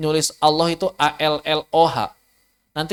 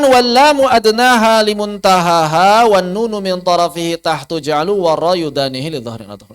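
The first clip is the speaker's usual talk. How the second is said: verse recitation; 2 semitones higher, 4 dB louder than usual. Shown as a demonstration. (melantunkan bait syair dengan lambat)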